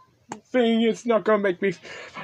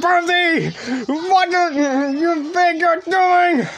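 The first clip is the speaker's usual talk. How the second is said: high, loud, slow alarmed yell; yelling at Percy; 8 semitones higher, 4 dB louder than usual. Real, not acted. Shouting at Percy for what he is doing, high alarmed yell.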